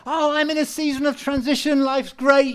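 cheerful, upbeat. Retold or imitated. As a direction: play the happy version people never say. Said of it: "they never say".

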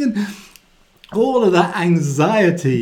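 normal talking voice

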